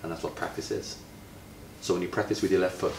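ordinary delivery

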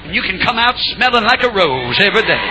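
normal talking voice

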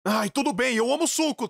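ordinary delivery, nothing marked

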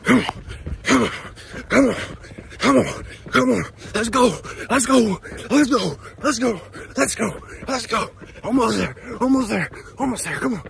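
high, strained pushing through effort; straining self-encouragement. Real, not acted. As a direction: urge yourself on, straining uphill. Pushing himself up the hill.

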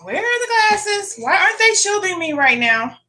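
high, squeaky mock outrage; eyes' indignant voice. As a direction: give the eyes a high, offended voice. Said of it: "My eyes are like".

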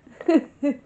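loud high burst of laughter; real loud laugh. Real, not acted. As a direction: crack up at the memory. Laughing at own story.

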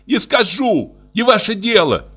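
high-pitched defiant cry; upset sister crying out; tearful shout. A narrator's reading. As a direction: refuse him in a shrieked pitch, not much volume. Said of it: "закричал на него заплаканная Алька".

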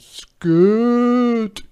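high, loud, drawn-out cow; long storybook cow bellow. A narrator's reading. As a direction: shoo it away with a mooing stretch. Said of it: "the cow said".

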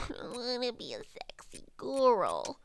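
slow, drawn-out hammed-up voice; silly put-on voice. Player jokes about their game goal, silly put-on voice. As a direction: draw out the last word absurdly. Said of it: "saying that with that voice".

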